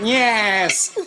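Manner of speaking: high, giddy gnome voice; gleeful little gnome cheer; squeaky gnome yes. Acted.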